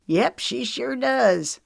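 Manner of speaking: beaming with pride; proud. A narrator's reading. Father agreeing proudly that his daughter looks like her. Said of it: "Knox said proudly".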